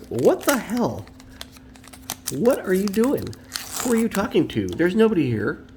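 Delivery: high, put-on cat voice, baffled; voicing the cat. Owner voicing what his staring cat thinks of him, high and baffled. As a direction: speak for the cat, puzzled and bossy. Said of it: "My cat is staring at me like".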